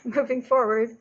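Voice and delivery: light laugh into the words; chuckle, then carry on. Real, not acted. Laughs after the whispered aside.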